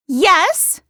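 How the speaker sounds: high, loud, indignant yes; exasperated reply. A narrator's reading. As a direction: fire back at the accuser. Accused woman snaps back.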